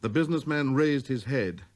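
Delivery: plain reading voice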